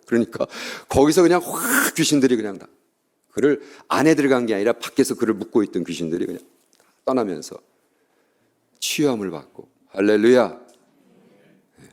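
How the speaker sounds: usual speaking voice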